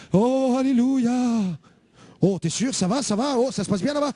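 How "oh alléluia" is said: slow, stretched dreamy alleluia; drawn-out rapture. Retold or imitated. Young man lost in trance answers the pastor, slow rapture. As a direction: let the alleluia float away slowly.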